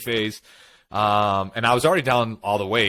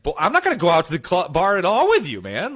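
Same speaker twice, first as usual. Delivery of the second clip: higher, louder, fast indignant retort; mock exasperated outburst; 6 semitones higher, 4 dB louder than usual. To a friend pushing him to drink, a hammed-up fed-up retort. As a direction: fire back at the friend, fed up and quick.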